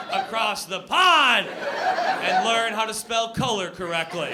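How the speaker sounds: hammed-up indignant climax; mock patriotic tirade. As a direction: stretch it out as a scolding yell.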